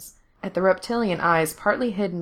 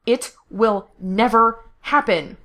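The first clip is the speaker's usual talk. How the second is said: loud, slow, higher-pitched refusal; snapping; 4 semitones higher, 5 dB louder, slower than usual. A narrator's reading. Captive refuses his enemy's order, snapping. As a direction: snap it word by word, emphatically. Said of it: "Gerard snapped".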